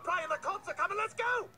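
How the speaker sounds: high, panicked urging; urgent alarm. Acted. Urging partner to flee the police, urgent alarm. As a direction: urge him out, pitch climbing.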